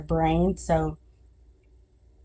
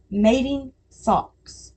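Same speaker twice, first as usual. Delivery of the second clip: "Mating socks" said slowly, each word drawn out; 4 semitones higher, much slower than usual. Shown as a demonstration. Mom tests the phrase on viewers, slow and deliberate. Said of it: "say it like that".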